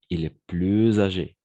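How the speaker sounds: "plus âgé" said as "plu-zâgé", the s linked as z; liaison in plus âgé. Shown as a demonstration. Teacher demonstrating the liaison to students, deliberately. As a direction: link "plus" into "âgé" with a z sound. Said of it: "on va faire une sorte de liaison".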